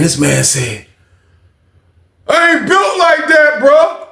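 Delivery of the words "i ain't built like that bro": mocking Jay. Mocking Jay's refusal.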